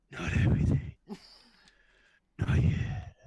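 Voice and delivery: forced whispery Batman impression; husky, voiceless superhero rasp. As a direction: push the words out on pure breath. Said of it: "It was Bat-, that was in".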